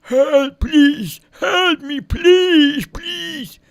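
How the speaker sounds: high, loud, crying pleas for help. A narrator's reading. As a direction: call out in tears, begging. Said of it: "another voice calling for help".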